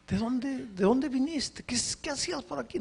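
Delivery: high, quick, pressing questions; puzzled questioning. Retold or imitated. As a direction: fire questions at a silent stranger. Questioning the silent Indian.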